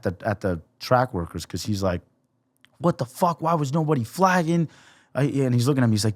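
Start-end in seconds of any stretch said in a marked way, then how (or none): 2.8-4.7 held-back mock scream, pitched up; retold screaming. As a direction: imitate an outraged brother without shouting. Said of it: "came over screaming and yelling".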